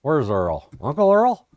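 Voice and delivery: hollering around for a man named Earl, Uncle Earl pitched high; jokey shout for Uncle Earl; mock calling for Uncle Earl. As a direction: playfully call out looking for Earl. Mock confusion: oil as a man.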